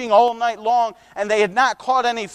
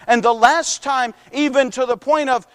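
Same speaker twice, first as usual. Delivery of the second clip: ordinary delivery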